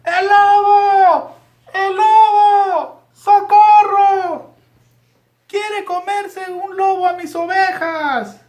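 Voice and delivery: high, drawn-out shepherd's cries of wolf; high-pitched, slow, loud call for help; shouting for help. A narrator's reading. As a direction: cry out loudly, stretching every word. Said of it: "comenzó a gritar".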